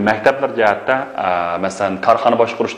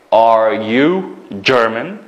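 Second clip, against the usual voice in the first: Are you German, very slow with a rising end; slow question; much slower than usual. Shown as a demonstration. Teacher repeating the question slowly for pupils. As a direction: draw it out slowly for the class.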